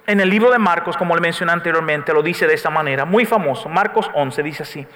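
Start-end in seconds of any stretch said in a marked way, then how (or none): none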